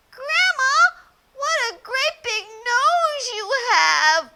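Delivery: put-on a child marveling at something odd; wide-eyed little girl. Little girl marvels at grandma's big nose. Red's voice.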